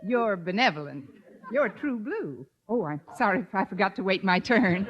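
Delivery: ordinary talk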